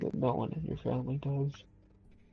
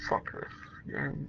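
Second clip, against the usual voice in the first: slow, soft, voiceless mutter of disgust at the game; frustrated mutter; 7 dB quieter, much slower than usual. Real, not acted. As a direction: grumble it under your breath, dragging it out.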